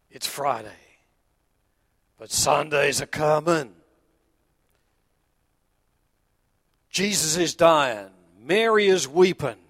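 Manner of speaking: put-on old preacher. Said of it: "The old Negro preacher said".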